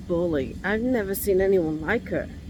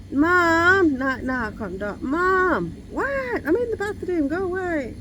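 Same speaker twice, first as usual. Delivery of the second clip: shouting through the door; 7 semitones higher than usual. Retold or imitated. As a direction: call out, annoyed, through a locked door. Acts out calling through bathroom door.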